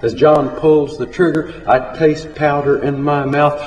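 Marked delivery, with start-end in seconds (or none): none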